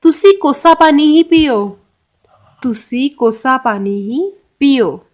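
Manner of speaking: slow, careful Punjabi for learners; slow teaching demo. Shown as a demonstration. Teacher to students showing the Punjabi phrase, slow and careful. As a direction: spell it out slowly so students can copy. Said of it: "इसको पंजाबी में बोला जाता है कोसा पानी".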